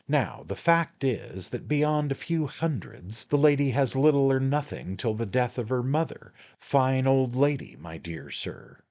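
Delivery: high, wheedling man-of-the-world patter; a hint of a knowing little lawyer; sly attorney, coaxing. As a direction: draw him in like a confidant. The attorney talks in the story.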